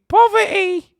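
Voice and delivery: very high, loud British falsetto on "Poverty"; high-pitched British voice. Shown as a demonstration. Streamer performing "Poverty" for chat, high-pitched British voice. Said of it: "Please do the high pitch".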